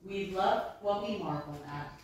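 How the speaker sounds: normal talking voice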